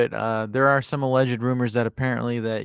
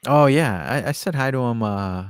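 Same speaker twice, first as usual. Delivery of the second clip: ordinary delivery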